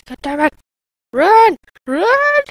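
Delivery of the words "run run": high, loud panic shout; panicked yell. Real, not acted. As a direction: yell to flee. Fleeing zombies.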